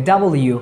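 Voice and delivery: "ডাবল ইউ" as double plus you; correct W. Shown as a demonstration. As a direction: say "ডাবল ইউ" as two clear words. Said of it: "এইভাবে আমরা উচ্চারণ করবো". ("ডাবল ইউ", ডাবল আর ইউ মিলিয়ে)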